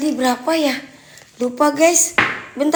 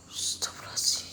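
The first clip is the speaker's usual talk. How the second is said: breathy frightened whisper; 15 dB quieter than usual. Real, not acted. Whispered prayer in fear.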